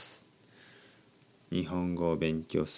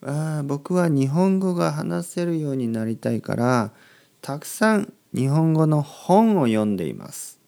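lightly voiced keen learner, higher pitch; a slightly raised voice quoting an eager student; example learner; 6 semitones higher, 3 dB louder than usual. Teacher voices a learner who reads to learn speaking, keen and lightly acted. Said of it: "例えば".

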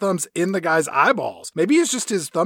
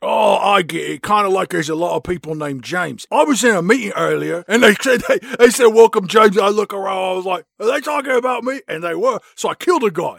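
dim-witted hero; 4 semitones higher, 3 dB louder than usual. Acted. Acts dim Bond explaining to Moneypenny.

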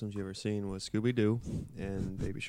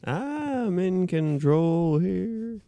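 slow, loud, much higher mock command; mock authority; 9 semitones higher, 10 dB louder, much slower than usual. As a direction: proclaim it like a pompous ruler. Mock power claim by sound guy.

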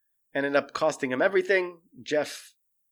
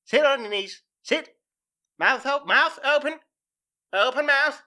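much higher, louder, barked commands like a trainer; tiger trainer commands; 10 semitones higher, 3 dB louder than usual. Retold or imitated. Joe commanding the husband like his tigers, higher and louder. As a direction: order him around like an animal. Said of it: "like he trains his tigers".

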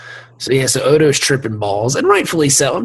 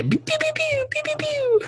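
high squeaky zap sounds; pew pew noises; 7 dB quieter than usual. Retold or imitated. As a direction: make little laser noises. Imitated firing noise.

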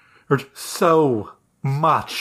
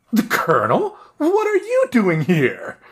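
surprised; 7 semitones higher than usual. Acted. GM voices startled character.

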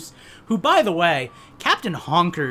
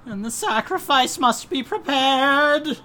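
slow, higher dramatic cult leader; ominous villain voice; 6 semitones higher, slower than usual. Acted. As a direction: intone it slowly like a prophecy. Ominous stream intro bit.

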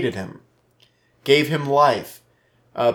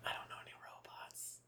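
whispered aside; 10 dB quieter than usual. Real, not acted. Aside about the beer.

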